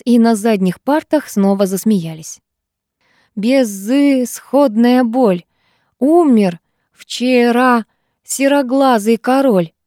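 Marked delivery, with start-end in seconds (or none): 3.4-9.7 very slow, drawn-out hammy poem reading; overdone recitation. A narrator's reading. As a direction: ham up the poem like a bad schoolboy reciter. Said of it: "с выражением же". (нарочито медленно, с пафосом, с выражением)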